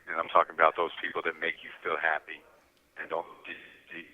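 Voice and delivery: ordinary talk, nothing marked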